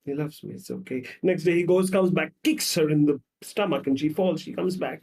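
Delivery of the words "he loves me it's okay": soft, repeated self-convincing; quietly acted hurt woman; understated weeping act. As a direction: convince yourself in a small voice. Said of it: "and she's weeping".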